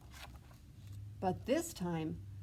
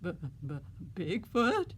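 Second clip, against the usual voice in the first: stammering fright. Acted. Ben facing Bigfoot in shock, stammering. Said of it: "And Ben says".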